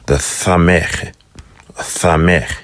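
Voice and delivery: "thameh" said slowly with a lisped TH. Shown as a demonstration. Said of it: "lispy S".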